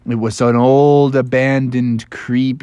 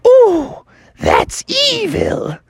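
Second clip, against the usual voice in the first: squeaky Robin voice; much slower than usual. Acted. Robin gasps at evil.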